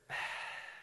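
acted troubled sigh; quiet, dejected exhale; heavy sigh. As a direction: breathe out softly before confessing. Acting the troubled camper's sigh.

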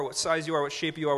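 normal talking voice

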